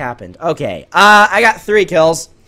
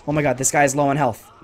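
ordinary talk

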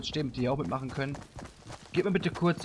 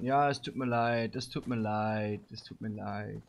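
slow, low, fading apology; mock-sorry; 5 semitones lower, 4 dB quieter, slower than usual. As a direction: trail off lower and softer with each repeat. Repeated apology to chat. (langsame, leiser werdende Entschuldigung)

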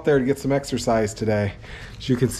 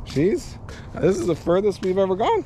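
ordinary talk

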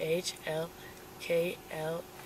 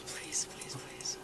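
begging in a breathy whisper; whispered pleading; 10 dB quieter, slower than usual. Real, not acted. Pleading during a sobriety stop.

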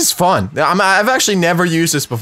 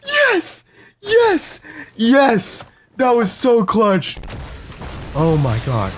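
overwhelmed triumph; 8 semitones higher, much slower than usual. Real, not acted. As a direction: squeal out the win, spent but elated. Breathless celebration after the win.

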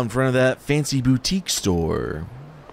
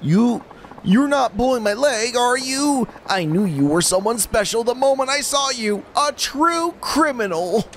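much higher, whimpering nervous guy; whiny lovesick man; 13 semitones higher than usual. Acted. Fool despairing to Majima about his problem. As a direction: play the pathetic lovestruck fool.

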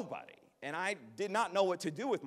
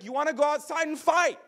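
高声挑衅要打架; high, loud aggressive dare; challenging to fight; 11 semitones higher, 9 dB louder than usual. Retold or imitated. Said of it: "he's like".

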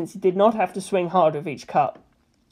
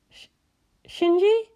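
slightly nervous; 14 semitones higher than usual. A narrator's reading. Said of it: "slightly nervous".

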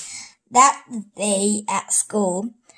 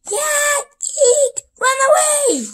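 much higher squeaky voice; squeaky cheer; 4 dB louder than usual. Acted. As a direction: squeal it out like a tiny excited mouse. Character cheering on robot.

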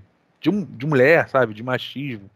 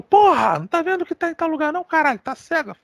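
mock furious snap, much higher; imitating angry yell; 13 semitones higher, 3 dB louder than usual. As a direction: hammer the jerk yelling at his wife. O cara xinga a mulher. (imitando o cara gritando com a esposa, bem agudo)

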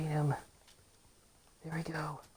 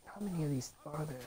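ordinary delivery

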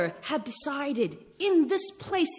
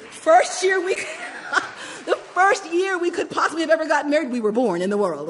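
laughing, voice catching, louder; emotional laughter; 4 semitones higher, 5 dB louder than usual. Real, not acted. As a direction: laugh through real emotion.

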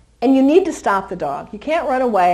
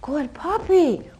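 slow praise; 4 semitones higher, much slower than usual. Real, not acted. Praising puppy.

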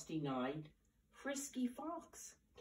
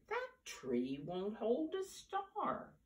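higher and slow, a hint of a small bear calling; cried out; 7 semitones higher than usual. Little Bear calling from far off about the tree, higher and slow. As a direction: call it out, drawn out and up. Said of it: "cried out".

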